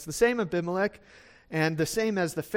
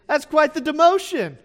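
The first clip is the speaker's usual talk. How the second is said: loud, laughing through the joke; 9 semitones higher, 9 dB louder than usual. Real, not acted. Preacher laughing at his own joke to the congregation. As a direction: laugh it out, amused.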